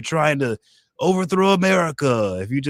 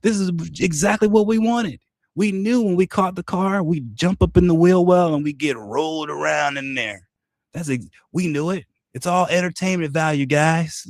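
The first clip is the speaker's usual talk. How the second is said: much higher, falsely breezy voice; mock smug pretending; 7 semitones higher than usual. Opponents pretending the disaster was planned, high mock smugness. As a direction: play it off as all planned, smug. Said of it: "they're pretending like".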